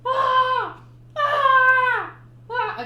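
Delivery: repeated high, loud mock screams; hammed-up cries of being shot; pained yells. Ace Ventura shot again, repeated mock screams. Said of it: "and he's like".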